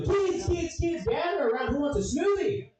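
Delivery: put-on high, bright calling voice to kids; chirpy pitchman. School presenter calling the kids over, chirpy and high. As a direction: lure the kids in like a cheery salesman.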